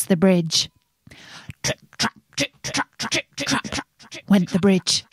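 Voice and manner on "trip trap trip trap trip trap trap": soft, staccato trip-trap hooves; hoofbeat imitation. A narrator's reading. Narrator imitates goat crossing the bridge, soft hoofbeats. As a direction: tap out the words like little hooves. Hoof sounds imitated in story.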